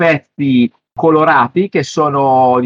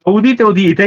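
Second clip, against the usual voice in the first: town crier; 8 semitones higher, 4 dB louder than usual. Pretend. Calls listeners like a herald.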